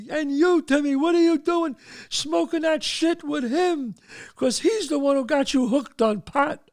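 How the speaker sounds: high-pitched scolding rant; angry father. Retold or imitated. Father turns on Timmy over smoking, high angry scolding. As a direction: tear into your son, voice way up.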